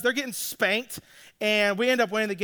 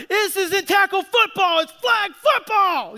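high, loud indignant shout; mad kid; 10 semitones higher, 5 dB louder than usual. Retold or imitated. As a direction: imitate a furious boy yelling his protest. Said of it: "he's mad, he's so mad".

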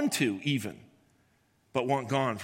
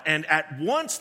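usual speaking voice

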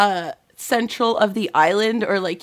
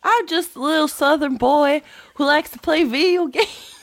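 high, hammed-up Southern boy; mock a little Southern boy on the edge of laughter; high-pitched teasing Southern accent; Matt impression; 5 semitones higher than usual. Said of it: "do you have an impression of Matt".

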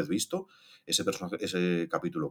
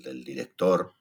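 ordinary talk

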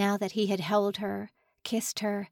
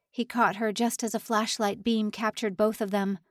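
plain reading voice

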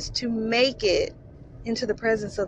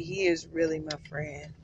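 usual speaking voice

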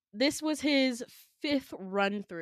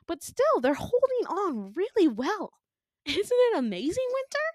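high, delighted gushing; gleeful villain; 11 semitones higher than usual. Retold or imitated. Villain showing Winter her suffering friends, gleeful. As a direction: gush high with a little laugh.

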